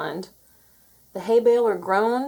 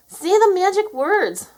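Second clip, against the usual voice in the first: high, loud, stretched-out cry; urgent shout; 12 semitones higher, 6 dB louder than usual. A narrator's reading. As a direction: shout it over the commotion. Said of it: "shouted Moo".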